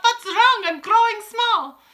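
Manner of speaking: high, loud, drawn-out alarm; boy wailing in dismay; crying out. A narrator's reading. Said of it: "cries out".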